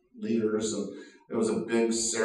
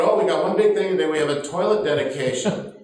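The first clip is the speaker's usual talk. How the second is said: cheerful announcement; 5 semitones higher, 7 dB louder than usual. Retold or imitated. Hosts announce happily.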